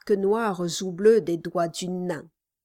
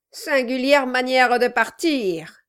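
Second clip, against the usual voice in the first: sarcastic dwarf voice; 5 semitones higher, 6 dB louder than usual. Acted. Dwarf feigning surprise.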